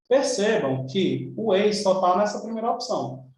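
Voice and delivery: ordinary talk, nothing marked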